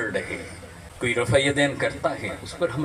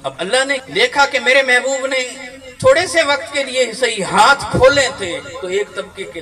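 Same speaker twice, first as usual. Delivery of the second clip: much higher and louder impassioned preaching with laughter; fervent preaching; 12 semitones higher, 11 dB louder than usual. Acted. Preacher to congregation, soaring fervent preaching. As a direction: lift the hall with joyful fervour.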